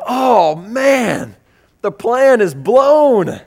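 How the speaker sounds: loud, high, drawn-out moan; dismayed wail.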